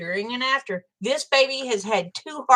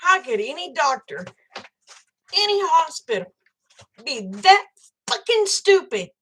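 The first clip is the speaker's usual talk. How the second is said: outraged; 5 semitones higher than usual. Real, not acted. Outraged at the hospital.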